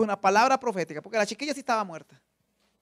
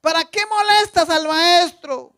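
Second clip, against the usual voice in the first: enemy's voice; 7 semitones higher, 11 dB louder, slower than usual. Acted. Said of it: "La voz del enemigo".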